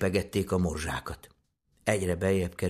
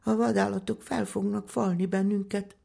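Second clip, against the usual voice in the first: put-on very high, plaintive child voice; high-pitched childlike lament; tearful little girl; 12 semitones higher than usual. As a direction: wail it like a scared little girl. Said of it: "sírta el magát Juliska".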